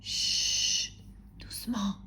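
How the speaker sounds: long shush, then a soft word; hushing. Pretend. Storyteller shushing children before Monsieur Silence, softly hushing. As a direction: hush the little listeners gently. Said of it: "Parlons doucement".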